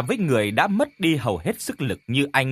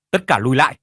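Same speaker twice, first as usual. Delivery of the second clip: commanding shout; 6 dB louder, much faster than usual. Acted. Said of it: "tiếng thét ấy".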